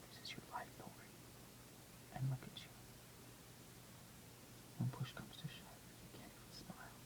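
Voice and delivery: hushed whisper to herself; barely voiced self-reproach; whispered inner thought. Inner voice in hospital bed.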